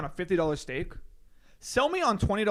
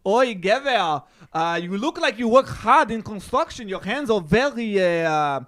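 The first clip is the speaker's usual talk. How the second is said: put-on Israeli accent; 3 semitones higher, 5 dB louder than usual. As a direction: sell hand cream in an Israeli accent. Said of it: "with his fucking Israeli accent".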